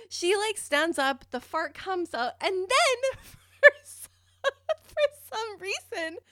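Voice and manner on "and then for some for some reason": high, slow, through laughter; laughing, barely holding it together. Real, not acted. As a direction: fight the giggles to get it out. Building up to punchline laughing.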